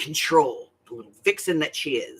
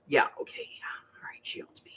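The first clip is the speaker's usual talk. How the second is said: whispered, fading resignation; stunned surrender; 10 dB quieter than usual. Retold or imitated. Grandmother admitting the baby owns her, whispered surrender. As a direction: give in under your breath. Retold defeated surrender after shock.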